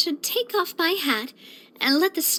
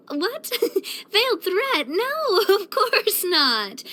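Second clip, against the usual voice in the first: higher, giggly protest; laughing denial; 4 semitones higher, slower than usual. Acted. Laughs off suspected threat.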